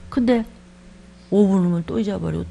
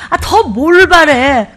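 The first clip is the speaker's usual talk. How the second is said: much louder, slow and drawn out; exasperated cry; 5 semitones higher, 11 dB louder, much slower than usual. Real, not acted. Preacher chiding the congregation for wanting more, loud and slow. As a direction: rebuke them in disbelief.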